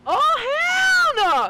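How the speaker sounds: shrieked, very high "oh hell no"; a girl's outraged reply, hammed up high and loud; squealed mock sass. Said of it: "mocking sassy girl".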